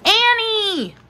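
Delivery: high call, falling at the end; high-pitched drawn-out call. A narrator's reading. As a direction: call the name after someone, pitched high. Boy calling after his sister.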